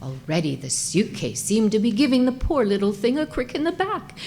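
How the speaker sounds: plain reading voice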